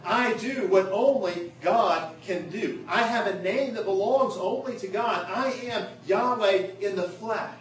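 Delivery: preaching at full force. Acted. Preacher voicing Jesus's claim to be God, forceful proclamation. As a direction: proclaim each phrase with ringing force.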